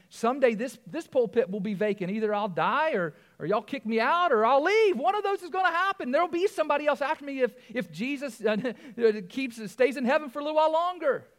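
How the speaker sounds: fired-up preacher pleading with his church; full-force preaching. Acted. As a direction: drive it home from the pulpit.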